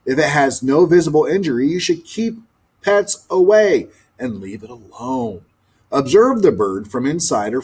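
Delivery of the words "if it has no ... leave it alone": plain reading voice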